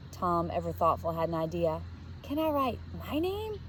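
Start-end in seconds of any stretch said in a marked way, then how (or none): 2.2-3.6 put-on a child asking eagerly in the dark; eager whisper. A narrator's reading. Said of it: "he whispered eagerly".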